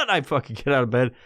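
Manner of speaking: usual speaking voice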